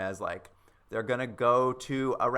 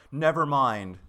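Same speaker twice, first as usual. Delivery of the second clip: louder, sulky give-up line; mock huffy dismissal; 3 semitones higher, 8 dB louder, slower than usual. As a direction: storm off in a hammed-up huff. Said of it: "He's just like".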